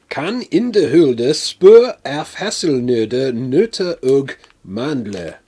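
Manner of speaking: jokey fake Swedish accent reading the label; mock Swedish accent. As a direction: read the packet in an over-the-top Swedish accent. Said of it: "offended a bunch of Swedes with that accent".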